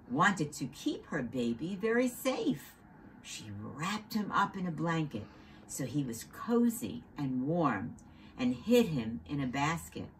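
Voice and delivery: ordinary delivery, nothing marked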